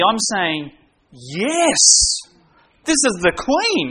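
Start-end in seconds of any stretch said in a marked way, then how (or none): 1.1-3.9 bright, higher pitched welcome to royalty; eager yes. Retold or imitated. The preacher picturing the Queen at his door, high and thrilled. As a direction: jump at the chance, thrilled.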